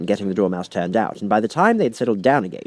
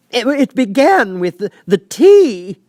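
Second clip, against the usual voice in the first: high, loud, slow quavering plea; trembling Hatter; 14 semitones higher, 10 dB louder, slower than usual. A narrator's reading. Hatter pleads with the King, trembling high and slow. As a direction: beg for mercy, voice shaking.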